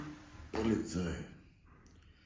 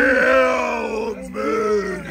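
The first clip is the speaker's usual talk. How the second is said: drawn-out shout; 5 semitones higher, 4 dB louder, much slower than usual. Real, not acted. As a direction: bellow it out slowly. Drunken shouting at party.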